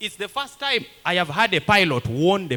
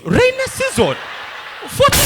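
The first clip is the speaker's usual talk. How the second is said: "Rainy season?" thrown up much higher and louder; incredulous; 3 dB louder than usual. Real, not acted. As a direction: scoff at the idea, pitch shooting up. Incredulous echo of the claim.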